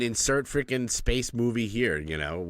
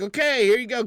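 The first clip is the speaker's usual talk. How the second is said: chipper handoff; 7 dB louder than usual. Retold or imitated. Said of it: "I was like".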